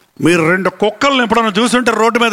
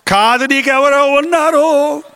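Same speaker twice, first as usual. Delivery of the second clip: higher, drawn-out suspicious insistence; mock accusation; 5 semitones higher, slower than usual. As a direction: stretch it out like a jealous accuser. Acted accusation of a husband.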